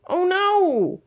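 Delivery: high alarmed dismay. A narrator's reading. Mouse told to stay with coats, alarmed. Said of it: "thought Fergus".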